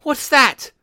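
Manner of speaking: put-on high, loud old woman's outcry; startled high-pitched yelp of a question; startled cry. A narrator's reading. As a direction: cry out in sudden alarm. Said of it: "cried the old woman, starting up".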